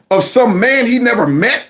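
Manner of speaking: ordinary talk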